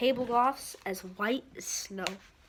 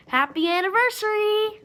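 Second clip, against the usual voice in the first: high, loud sheepish froggy voice; croaked; 10 semitones higher, 8 dB louder than usual. Acted. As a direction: squeak it out embarrassed. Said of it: "he croaked".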